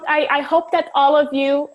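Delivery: ordinary delivery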